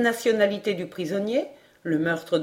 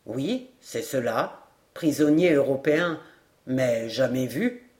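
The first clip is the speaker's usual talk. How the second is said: recollecting sergeant; 7 semitones lower than usual. A narrator's reading. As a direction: draw the words out in a low register. Sergeant recalls prisoner in dialogue.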